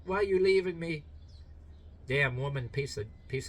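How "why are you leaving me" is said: put-on abandoned dog as child. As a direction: imitate a left-behind pup whining for Mom. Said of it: "The dog is like your kid".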